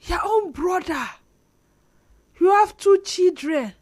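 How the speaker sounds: Nollywood melodrama. Retold or imitated. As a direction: wail it like a Nollywood film. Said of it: "there's one soundtrack. Say".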